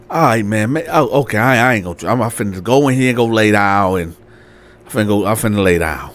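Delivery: low-pitched, hesitant giving up; resigned self-talk. Acted. Landlord deciding to go lay down, resigned. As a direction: talk yourself back to bed, low. Landlord gives up, mumbles to himself.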